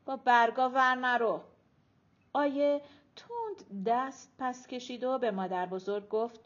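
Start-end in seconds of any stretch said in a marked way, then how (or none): 0.0-1.4 mild nagging, louder; grumbling. A narrator's reading. Arezu nagging Ayeh about the leaves, grumbling. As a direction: grumble a scolding at someone fiddling. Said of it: "آیه غر زد".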